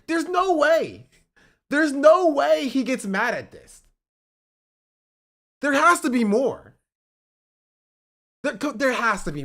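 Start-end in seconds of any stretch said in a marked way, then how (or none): 0.1-6.7 slow, louder and higher, no way; can't believe what he's seeing; loud disbelief. Real, not acted. Reacting to the clip, slow loud higher disbelief. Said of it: "disbelief rising".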